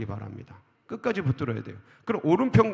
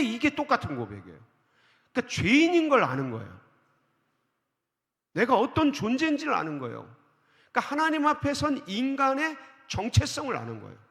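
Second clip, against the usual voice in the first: higher, louder, rising sermon climax; preaching at full force; 8 semitones higher, 3 dB louder than usual. Acted. Preacher to congregation on the sinner's confession, fervent preaching. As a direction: build the sermon to a ringing peak.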